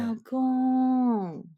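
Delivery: high, eerie female call; a ghost calling her husband; ghost's call. Retold or imitated. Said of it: "一个女生的声音喊他".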